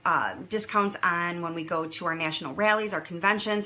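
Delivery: normal talking voice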